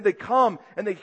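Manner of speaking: ordinary delivery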